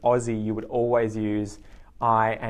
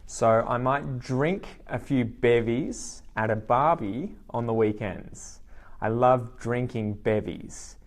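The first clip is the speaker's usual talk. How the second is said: slow and clear for learners; unhurried teacher demo; slow example sentences; slower than usual. Shown as a demonstration. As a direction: model each sentence slowly. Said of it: "give you some example sentences".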